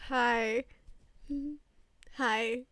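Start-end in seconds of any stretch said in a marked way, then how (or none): none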